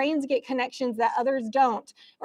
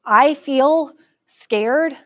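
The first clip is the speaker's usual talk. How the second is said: slow, deliberate I statement, louder; modelling an I statement; 3 semitones higher, 6 dB louder, much slower than usual. Shown as a demonstration. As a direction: lay out "I feel scared" word by word, slowly. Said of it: "I statements".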